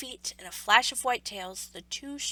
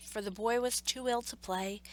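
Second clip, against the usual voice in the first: ordinary delivery, nothing marked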